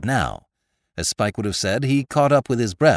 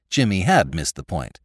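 ordinary delivery

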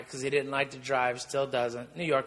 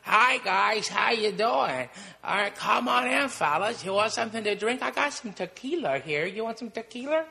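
much higher, put-on voice of an old comic at the door; Buddy Hackett impression; 7 semitones higher than usual. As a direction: greet them like a raspy old host, pitched up.

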